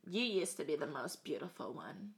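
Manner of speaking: put-on sinister old woman. Queen voiced in story.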